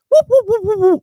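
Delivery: rapid, very high comic whoops; loud falsetto stooge yelping; Curly. Retold or imitated. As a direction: fire off silly high whoops in a string. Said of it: "Curly. He sounded like him".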